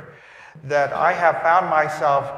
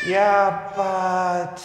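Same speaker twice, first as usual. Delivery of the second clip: inner doubting voice; 5 semitones higher than usual. Retold or imitated. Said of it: "the little voice is going".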